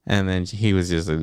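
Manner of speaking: ordinary delivery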